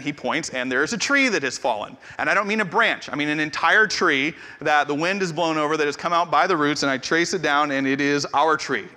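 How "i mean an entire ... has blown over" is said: ordinary talk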